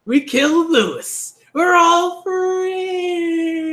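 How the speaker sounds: high, drawn-out cry of victory; triumphant reading. Acted. Reader voicing the hero announcing victory, drawn-out triumphant cry. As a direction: stretch out the free like a cheer.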